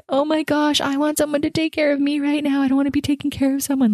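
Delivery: high, whiny plea, speeding up at the end; retold whine. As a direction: whine like an exhausted caregiver who needs care. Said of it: "I was just like".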